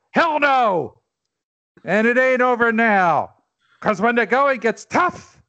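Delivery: fiery, rising rallying cry; roaring rallying speech. Acted. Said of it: "you're gonna read a famous speech".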